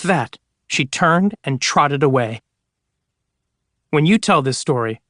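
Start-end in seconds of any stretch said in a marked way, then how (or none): none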